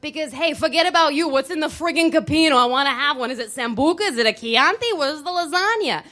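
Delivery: loud, high hammed-up Italian soprano; mock Italian soprano accent. To friends, hammed-up Italian soprano accent. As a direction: belt it like a mock Italian opera diva. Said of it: "practice our best Italian soprano accents".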